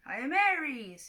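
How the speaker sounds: slow, booming insistence; a lightly voiced god of war; insisting war god. Fake Ares insists to Clarice, slow booming claim. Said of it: "he insisted".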